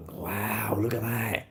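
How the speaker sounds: hushed, breathy wow, look at that; awed whisper. Retold or imitated. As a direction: whisper it like you're admiring a car you can't afford. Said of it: "it was like".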